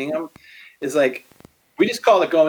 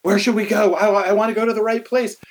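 anxious disciple; 5 semitones higher than usual. Retold or imitated. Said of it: "the disciples would have turned to him and said".

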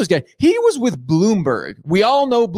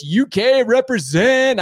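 slow, drawn-out cheer, pitched higher; hyped shout-out; 6 semitones higher, slower than usual. Real, not acted. Host greeting Dal from Twitch, hyped shout-out. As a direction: stretch it out like a proud hype call.

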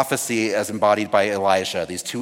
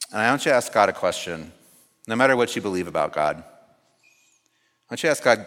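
calm grounding exercise. Acted. Leads silent prayer exercise.

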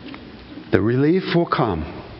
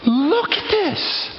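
high, slow drawn-out wonder; awestruck; 12 semitones higher, much slower than usual. Retold or imitated. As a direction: stretch it out in amazement. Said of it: "I was like".

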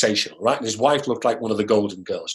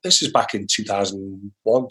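ordinary delivery

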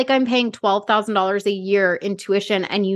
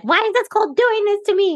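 mock whiny kid; 11 semitones higher than usual. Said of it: "And she's like".

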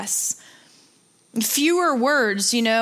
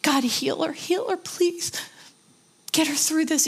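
higher, softening desperate plea; retold emotional prayer, trailing softer; pleading prayer; 6 semitones higher, 3 dB quieter, slower than usual. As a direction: beg God for a friend, pitch lifted. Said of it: "I just was saying".

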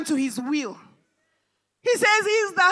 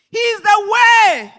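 slow, loud, higher preaching shout; shouted proclamation; 4 semitones higher, 7 dB louder, slower than usual. Acted. Preacher proclaims Jesus to the congregation, slow shout. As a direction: proclaim each word to the back row.

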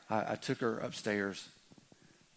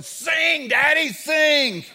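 put-on high, very loud small-child scream; Hannah screaming; 15 dB louder, much slower than usual. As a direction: wail a drawn-out demand like a toddler in a crib. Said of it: "Hannah's just screaming".

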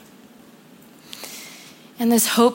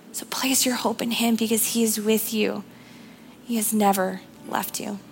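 hushed, breathy appeal to the congregation; whisper; 6 dB quieter than usual. Real, not acted. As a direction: lean in and whisper the comfort.

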